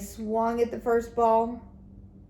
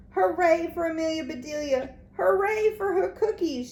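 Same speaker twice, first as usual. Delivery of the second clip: higher cheerful shout; 8 semitones higher, 3 dB louder than usual. A narrator's reading. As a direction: cheer the cookie maker. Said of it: "shouted the boys".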